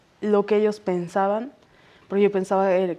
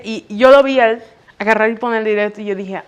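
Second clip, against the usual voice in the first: ordinary talk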